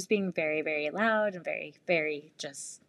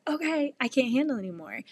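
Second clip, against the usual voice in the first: higher-pitched overwhelmed mom; exasperated; 7 semitones higher than usual. Retold or imitated. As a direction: act out reaching your limit. Overwhelmed mom's acted outburst.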